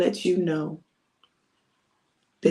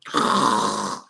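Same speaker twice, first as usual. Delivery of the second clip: long noisy sleeping breath; mock snore. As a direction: breathe out heavily as if fast asleep. Disciples fall asleep again.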